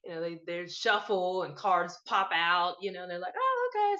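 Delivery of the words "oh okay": much higher, imitating a surprised reader; mock surprise. Tarot reader reacting to a popped-out card, mock surprised. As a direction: act out a sudden little "oh" moment.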